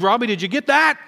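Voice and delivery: high, loud retold call to a friend; amazed, eager check that it was filmed; excited urgent check. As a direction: re-enact calling excitedly to your cameraman. Excitedly checking the filming again.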